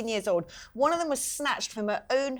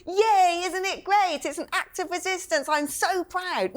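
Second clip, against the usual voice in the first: high, sarcastic imitation of gleeful celebration; mock cheering; 9 semitones higher, slower than usual. Mimicking the student's reaction to an atrocity, mock delighted. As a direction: cheer scornfully in a raised pitch. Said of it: "And there she is going".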